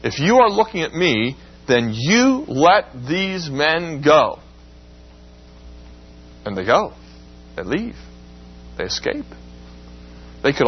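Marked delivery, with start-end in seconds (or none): 0.0-4.4 slow, firm command with the last words drawn out; Jesus ordering the soldiers to let his men go; imperative command. Shown as a demonstration. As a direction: lay down the order slowly. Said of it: "this is an imperative".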